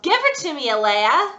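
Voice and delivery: an angry father's loud yell. Acted. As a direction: yell it to grab the doll back. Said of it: "He yelled".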